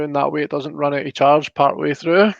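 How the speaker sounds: ordinary talk, nothing marked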